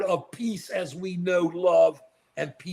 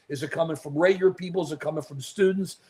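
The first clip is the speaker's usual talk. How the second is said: ordinary talk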